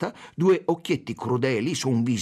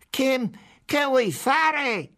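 high, slow, insistent yell; shouting; 4 dB louder, much slower than usual. A narrator's reading. Fat policeman repeating the interrogation at the consul, high shout. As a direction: shout the demand, dragging it out. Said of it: "gridò il poliziotto grasso".

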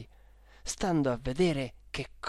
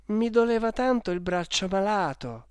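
put-on raised, plaintive boyish whimper; whining; 5 semitones higher, 3 dB louder than usual. Boy excusing himself with his bad arm, whining. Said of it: "con voce piagnucolosa".

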